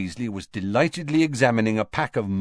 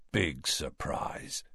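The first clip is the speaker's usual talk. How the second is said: soft, slow, sarcastic aside; dry whisper; 6 semitones lower, 11 dB quieter, slower than usual. A narrator's reading. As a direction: mutter it low and unimpressed. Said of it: "whispered Ron".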